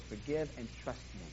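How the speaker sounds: God quietly reassuring a hurting listener; gentle voice of God. Acted. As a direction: speak God's words low and kindly. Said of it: "all God says to do is".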